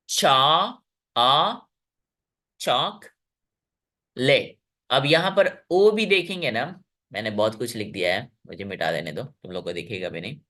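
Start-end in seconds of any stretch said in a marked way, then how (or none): 0.1-4.6 चा, its "aa" alone, then चॉकलेट split slowly; isolated vowel. Shown as a demonstration. Teacher showing vowel sounds, चॉकलेट slowly pulled apart. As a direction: stretch चा, echo आ, then break चॉकलेट in two. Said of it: "दो वेवल sounds हैं".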